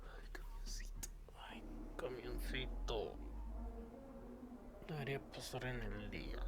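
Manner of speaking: very quiet, barely audible grumble to self; muttered aside. Real, not acted. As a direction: mutter it far under your breath while waiting. Aside while waiting out truck noise.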